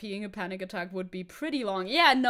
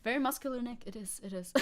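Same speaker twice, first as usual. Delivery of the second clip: normal talking voice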